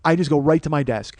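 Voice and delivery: normal talking voice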